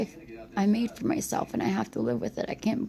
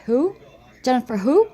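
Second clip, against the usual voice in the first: mildly irritated, louder questioning; mock a grandma's testy, rising question; imitating annoyed grandma; 3 semitones higher, 7 dB louder than usual. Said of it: "And she got mad".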